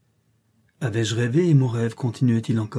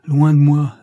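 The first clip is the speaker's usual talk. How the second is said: loud cast-off cry; 4 semitones higher, 9 dB louder, slower than usual. Acted. Master throws off his disguise.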